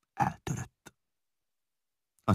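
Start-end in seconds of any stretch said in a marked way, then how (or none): none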